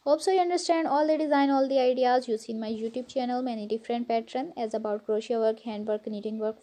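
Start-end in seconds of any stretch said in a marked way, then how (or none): 0.0-0.9 Pakistani English, warm vlog greeting; pakistani accent. Real, not acted. Whole vlog in own accent.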